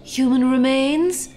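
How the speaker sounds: a put-on witchy hostess, sing-song and theatrical; campy horror host. Hostess signs off to her kiddies, hammy witch voice. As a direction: camp it up like a spooky TV hostess.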